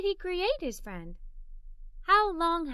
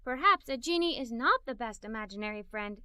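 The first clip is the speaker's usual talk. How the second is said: ordinary delivery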